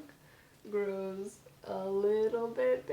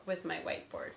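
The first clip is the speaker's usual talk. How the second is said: usual speaking voice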